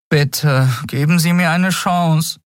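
put-on high, desperate boy's plea; pleading boy. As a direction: beg him for a chance. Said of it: "flehte der Junge". (hohe, flehende Jungenstimme)

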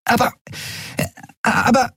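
high, halting stutter; flustered stammer. A narrator's reading. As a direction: stumble and restart on the word. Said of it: "Nelen kam ins Stottern".

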